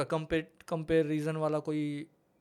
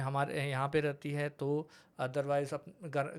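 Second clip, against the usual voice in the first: ordinary talk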